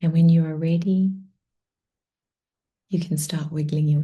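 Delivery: soft, hushed meditation voice, starting in a whisper; guided meditation. Acted. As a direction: gently bring them back to the room.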